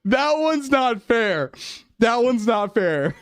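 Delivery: high, drawn-out complaint; slow, high-pitched plea of unfairness; embarrassed protest. Real, not acted. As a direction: whine that you've been wronged, slowly.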